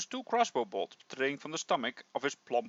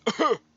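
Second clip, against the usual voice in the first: hacking cough; 9 semitones higher, 6 dB louder than usual. Acted. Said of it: "He coughs".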